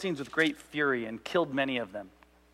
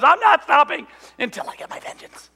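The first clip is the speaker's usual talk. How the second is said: higher, pushed vengeful voice; mock vengeful vow; 10 semitones higher, 6 dB louder than usual. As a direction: spit out the vow like a grudge. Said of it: "No, he goes".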